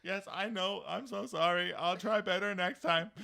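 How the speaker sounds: high, loud mock-contrite kid; hammed-up sorry, dragging out the promise; pleading apology. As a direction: whine it like a scolded son. Son apologizing to scolding dad.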